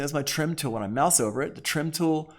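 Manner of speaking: usual speaking voice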